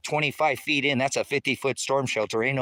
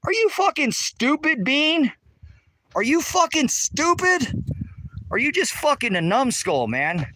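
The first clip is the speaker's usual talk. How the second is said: angry outburst; 11 semitones higher, slower than usual. Real, not acted. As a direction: blow up at the question in disbelief. Mocking a dumb viewer comment.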